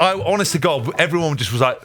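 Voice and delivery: usual speaking voice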